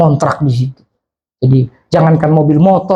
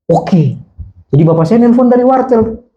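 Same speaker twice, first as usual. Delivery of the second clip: usual speaking voice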